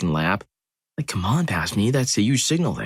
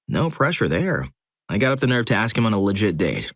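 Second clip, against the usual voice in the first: usual speaking voice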